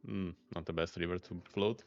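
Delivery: ordinary delivery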